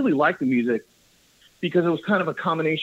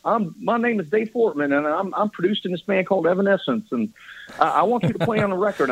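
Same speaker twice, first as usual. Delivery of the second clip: higher, quick Southern accent impression, retold; Southern accent quote, higher and faster; 6 semitones higher, faster than usual. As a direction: replay the Southern producer's pitch, laughing. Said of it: "that's what he said".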